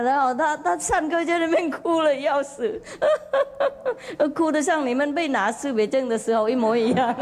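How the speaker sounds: high, laughing through the words; laughing while talking. Real, not acted. Monk teasing his students, laughing as he speaks.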